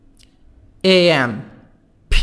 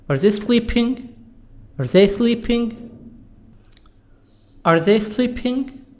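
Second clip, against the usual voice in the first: yes-no question lifting and held high on SLEEPING; higher-pitched question, ending up on SLEEPING; rising intonation; 4 semitones higher than usual. Shown as a demonstration. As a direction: let ARE THEY SLEEPING climb at the end.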